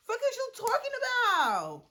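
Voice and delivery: high, mocking indignant question. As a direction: toss it back high and mocking.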